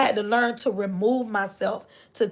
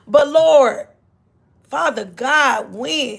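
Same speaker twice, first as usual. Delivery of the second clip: loud pleading; 2 semitones higher, 5 dB louder, slower than usual. Acted. Believer pleading with God.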